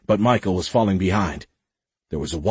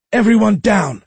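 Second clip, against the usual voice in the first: loud high command, fully yelled; shout; 13 semitones higher, 7 dB louder than usual. A narrator's reading. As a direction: bark the order at the room. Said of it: "she shouted".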